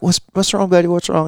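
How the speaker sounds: lightly voiced worried father; concerned dad. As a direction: ask a scared child what's wrong. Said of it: "I'm like".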